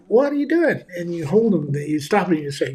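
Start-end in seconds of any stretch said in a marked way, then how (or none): 0.1-0.8 put-on high, startled cry of a scared kid; startled child. Child grabbed from the hot stove, startled and scared. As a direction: gasp it out like a frightened child. Frightened child caught by parent.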